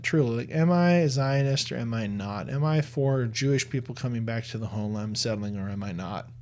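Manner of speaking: usual speaking voice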